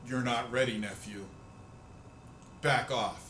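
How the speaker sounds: a touch of a stern Jedi uncle; Luke scolding nephew. Retold or imitated. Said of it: "show up and be like".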